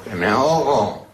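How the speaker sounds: slow, stretched-out mock cry; mock plea for help. Drowning man to a passer-by, drawn-out mock plea. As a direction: draw out the drowning man's complaint.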